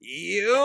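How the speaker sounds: high, loud drawn-out yell; mock warrior roar. West Belfast extra roaring on set, high mock yell. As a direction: let out a comic battle cry. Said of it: "all roar like yous are warriors".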